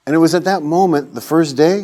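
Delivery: normal talking voice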